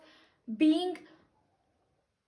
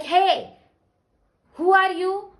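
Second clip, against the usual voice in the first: loud, higher, rude challenge; rude shout; 4 semitones higher, 7 dB louder than usual. Retold or imitated. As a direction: bark it at a stranger who interrupts. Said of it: "shouts at me".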